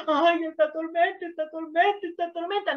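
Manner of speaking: lightly voiced self-pitying victim; mock lament. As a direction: whine about the storm, fading each time. Mocks a victim moaning in boat.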